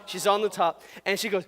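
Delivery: usual speaking voice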